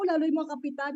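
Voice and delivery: ordinary talk, nothing marked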